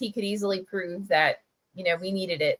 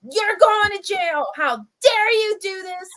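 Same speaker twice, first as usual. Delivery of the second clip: much higher, louder hysterical accusation; freaking out; 11 semitones higher, 4 dB louder than usual. Retold or imitated. Manager freaks out accusing the paper boy. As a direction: screech it in a frenzy. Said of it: "Freaking out".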